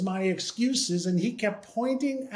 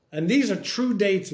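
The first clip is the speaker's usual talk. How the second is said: normal talking voice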